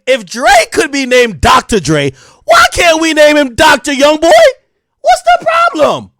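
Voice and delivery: loud, high comic outrage; indignant shout. Real, not acted. Arguing for the Doctor Young Boy nickname, indignant shouting.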